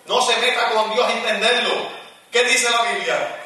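high, loud shouted praise; fiery preaching. Acted. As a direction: lift the congregation with full-voiced preaching. Preaching at full force.

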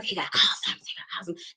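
hushed whisper to the gossips; whispered excuse. Retold or imitated. Whispering to seniors.

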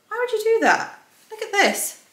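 high, incredulous disbelief. Real, not acted. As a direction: sound baffled and amazed. Shocked by fake buttonholes.

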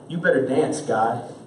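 ordinary delivery

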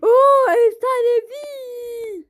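high, loud, thrilled cry; excited shout. Real, not acted. Gamer cheering at a legendary drop, high excited shout. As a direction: shout with joy at the find.

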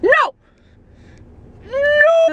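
high, loud yell of no at the bright phone screen; retold cry of no. Annoyed moviegoer yelling at a phone screen, high loud cry. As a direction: shout it up at the glaring phone. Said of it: "I was like".

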